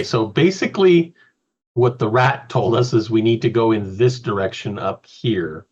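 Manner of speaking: normal talking voice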